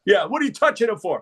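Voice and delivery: mock challenge in a high voice; retold challenge, high and demanding; challenging agent. Acting Secret Service challenging Cliff.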